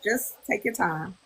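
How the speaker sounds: soft, slow, gentle reassurance; calm therapist. Retold or imitated. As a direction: give her space to cry. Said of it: "He's like".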